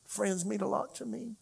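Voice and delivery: high, soft, choked-up thanks; weeping prayer, retold. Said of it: "I, I wept".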